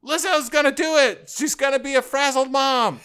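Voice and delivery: very high, loud excited squeal; gushing fan squeal. Acted. Fan reacting to [name] casting news, high squeal. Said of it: "And it's just like".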